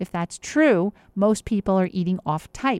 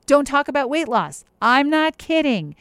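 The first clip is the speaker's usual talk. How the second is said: louder and higher, drive the rule home; insistent rule; 6 semitones higher, 6 dB louder than usual. Real, not acted. Coach telling listeners the weight-loss rule, loud and insistent. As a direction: punch the punchline like a Fight Club rule.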